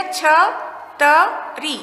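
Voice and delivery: छ, त, री as separate sounds with pauses. Shown as a demonstration. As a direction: spell out छ … त … री slowly for learners. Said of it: "क्या शब्द बन गया?". (छ, त, री अलग-अलग, रुक-रुक कर)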